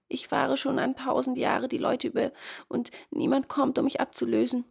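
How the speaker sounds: lightly voiced weary complaint; lamenting ferrywoman. A narrator's reading. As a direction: lament it in a lifted voice. Ferrywoman laments her endless task.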